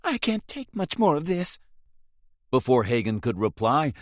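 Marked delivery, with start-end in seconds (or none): 0.0-1.6 higher, a touch of tremble; trembling voice. A narrator's reading. Frightened Rose after the attack, trembling. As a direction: hold back tears. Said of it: "Her voice trembled when she said".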